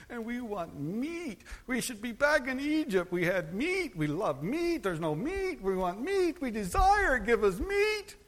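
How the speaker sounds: mock the people's childish whining for meat; grumbling Israelites. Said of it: "They grumbled and they complained".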